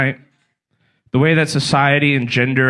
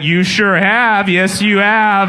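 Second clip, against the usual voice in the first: slow, drawn-out placating; patronizing reassurance; 6 semitones higher, 3 dB louder, much slower than usual. Retold or imitated. Stranger at a pool party placating him, slow and patronizing. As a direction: humor him slowly, like soothing a child. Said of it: "They'd be like".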